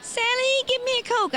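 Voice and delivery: very high, whining demand; whiny brother voice. Retold or imitated. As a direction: squeal it like a spoiled invalid brother. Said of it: "And he'd go".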